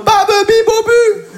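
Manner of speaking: put-on high-pitched held baby-babble syllables; mock articulation drill. Comedian imagining himself after ten sessions, high mock drill. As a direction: chant silly syllables way up high. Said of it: "J'arriverai en mode".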